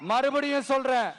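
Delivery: ringing political speech with long-held line endings; rally oratory. Real, not acted. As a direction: rouse a huge crowd, pausing for cheers. (மேடைப் பேச்சு, முழக்கமாக)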